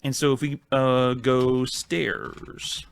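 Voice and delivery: ordinary talk, nothing marked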